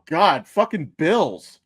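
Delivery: normal talking voice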